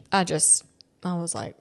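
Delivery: ordinary delivery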